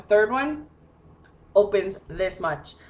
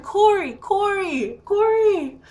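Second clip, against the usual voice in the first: high, drawn-out name calling; retold yelling; 12 semitones higher, 3 dB louder, slower than usual. Locked-out woman trying to wake partner, retold high yelling. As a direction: holler the name higher, as if through a window. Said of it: "I'm yelling and I'm banging".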